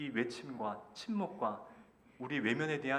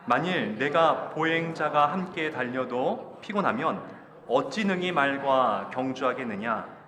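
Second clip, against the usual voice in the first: loud, slow, measured verse reading; scripture reading aloud; 2 semitones higher, 7 dB louder, slower than usual. Acted. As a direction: lead the congregation through the verse, drawing out each phrase. Leads congregational scripture reading. (천천히 힘주어 읽는 성경 봉독)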